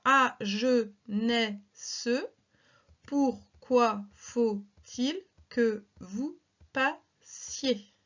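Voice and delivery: very slow, word by word scansion; slow verse reading. Shown as a demonstration. Teacher reads La Fontaine slowly for syllable counting. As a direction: draw out each syllable so pupils can count. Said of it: "Attention la diphtongue".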